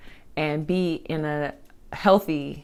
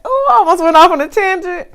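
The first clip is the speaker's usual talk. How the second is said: surprised; 9 dB louder than usual. Real, not acted. Catching herself mid-tangent.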